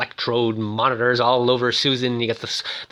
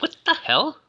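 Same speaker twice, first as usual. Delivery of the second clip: high-pitched imitated bafflement; alarmed disbelief. Susan finding herself locked in, alarmed disbelief. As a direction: act out a startled, indignant question.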